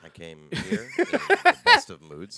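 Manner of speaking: hammed-up wounded pride; mock offended. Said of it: "You gotta stop doing that".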